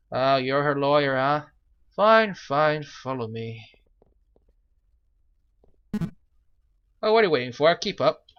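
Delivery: loud, impatient guard voice; an annoyed jailer hurrying the visitors along; surly guard. Acted. Prison guard leading Falcon to the cell, loud and impatient.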